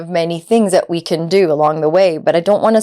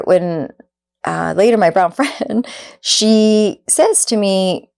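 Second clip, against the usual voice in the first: ordinary delivery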